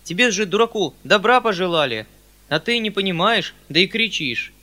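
громкий, повышенный укор; отчитай мужика с укоризной; укоризна. A narrator's reading. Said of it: "сукоризной обращаясь к мужику". (укоризненный выговор)

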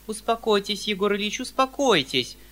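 high, loud alarmed lady; crying out. A narrator's reading. Anfisa Petrovna cries out to calm the uncle. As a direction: hurry to calm him. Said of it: "вскрикнула Анфиса Петровна".

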